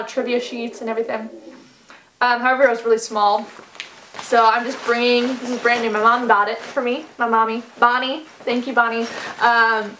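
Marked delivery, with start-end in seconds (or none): none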